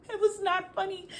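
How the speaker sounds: laughing through the words, up high; can't keep a straight face, real laugh. Real, not acted. Telling viewers her story while laughing.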